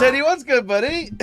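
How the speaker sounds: loud, high, delighted shout of a name; excited shout. Real, not acted. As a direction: call out the arriving viewer's name with joy. Greeting a viewer arriving.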